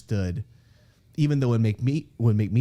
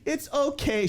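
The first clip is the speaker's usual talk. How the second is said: mock soothing fiancee; slower than usual. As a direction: console him like a child. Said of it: "And she goes".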